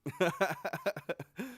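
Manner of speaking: high, soft chuckling run; real lingering laugh. Real, not acted. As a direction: keep giggling at your own joke. Cracking up at own joke.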